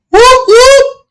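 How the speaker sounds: high, loud siren whoops; imitated police siren. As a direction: whoop like a squad car pulling someone over.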